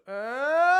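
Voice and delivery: sudden high loud sung note; high twangy vocal sound. Shown as a demonstration. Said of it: "you can hear this when I go higher".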